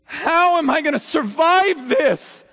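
high, loud, slow pleading how; anguished lament shouted to heaven; desperate lament. Acted. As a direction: cry out how in despair. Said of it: "the word how".

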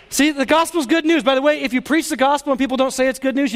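ordinary talk

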